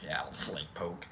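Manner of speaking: very soft, low retold answer; low quiet reply. Patient answers nurse before the needle, low quiet voice.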